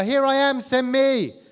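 high, loud eager cry of here I am; volunteer calling out to God, loud and high; eager shout. Acted. Acting eager volunteer prophet.

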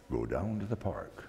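low, distant inner voice calling; a low voice from nowhere, insisting; mysterious voice. Retold or imitated. The voice repeats while reading.

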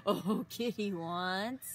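plain reading voice

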